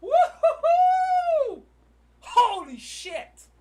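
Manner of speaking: long, high, very loud whoop; real jubilant shout; elated yelling. Real, not acted. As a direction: whoop and holler, drawn out slowly. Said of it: "Sorry for yelling".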